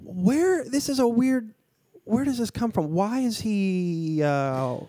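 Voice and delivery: baffled, where does this come from; retold confusion. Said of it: "And I was like".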